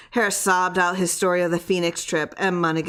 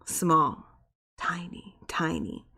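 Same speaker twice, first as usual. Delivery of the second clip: shrinking softer to a whisper; fading to tiny; 5 dB quieter, slower than usual. A narrator's reading. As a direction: let the voice dwindle away. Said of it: "Monaghan's voice got small".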